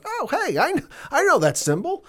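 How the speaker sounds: very high, squeaky little creature voice; hammed-up squeaky alien recognizing a symbol. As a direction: pitch it way up like a tiny alien. Voicing the alien Noti.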